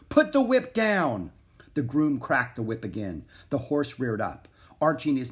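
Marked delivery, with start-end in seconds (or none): 0.1-1.3 high, loud, slower order; shouted command. A narrator's reading. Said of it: "shouted Prince Alexander".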